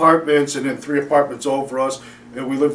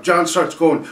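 normal talking voice

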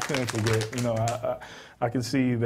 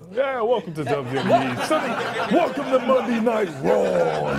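high, loud wrestling ring announcer; WWE announcer; 9 semitones higher, 5 dB louder than usual. Pretend. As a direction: hype it up like Monday Night Raw. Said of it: "You sound like a W W E announcer".

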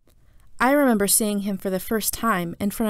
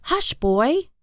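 higher, mildly angry shushing; angry hush; 6 semitones higher, 3 dB louder than usual. A narrator's reading. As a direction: shush the boy with some anger. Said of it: "her voice angry".

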